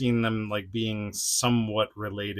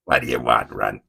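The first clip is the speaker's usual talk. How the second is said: much lower, louder growling brute voice; gruff Sabretooth; 13 semitones lower, 5 dB louder than usual. Pretend. Sabretooth toy snarls at Wolverine, low and gruff.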